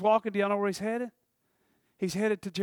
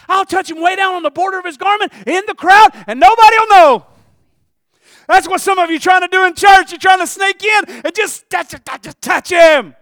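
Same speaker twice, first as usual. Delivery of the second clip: high, very loud sermon shout; full-force preaching; 12 semitones higher, 18 dB louder than usual. Acted. Preacher challenging church folk who sneak in, full-force preaching. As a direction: cry it out to the whole congregation.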